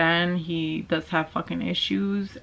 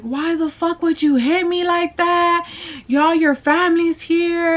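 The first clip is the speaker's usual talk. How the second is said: high-pitched, tearful wail imitating herself; retold crying protest; 10 semitones higher, 3 dB louder than usual. Girlfriend crying at the boyfriend who hit her, high retold wail. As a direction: wail it as if re-enacting the hurt. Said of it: "I was just crying and crying".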